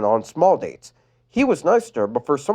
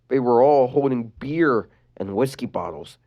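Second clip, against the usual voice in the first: plain reading voice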